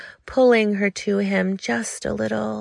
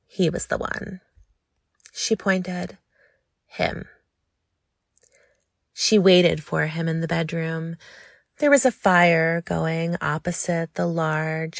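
ordinary delivery, nothing marked

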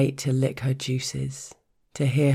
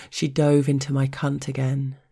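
ordinary delivery

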